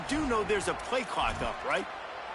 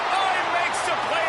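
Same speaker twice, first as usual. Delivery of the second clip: high, loud excited call; 10 semitones higher, 4 dB louder than usual. Acted. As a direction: call it like a huge play. Big play, interception on call.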